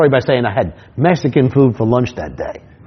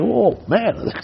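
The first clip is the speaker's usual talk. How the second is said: mock disgusted whoa; 9 semitones higher, 5 dB quieter than usual. Reacting to smell.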